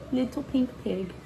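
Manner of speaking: ordinary delivery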